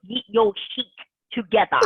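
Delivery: put-on Sophia's German accent on the quote. Quoting wife's catchphrase in German accent. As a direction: mimic her German accent, lightly. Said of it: "let me put the accent on it".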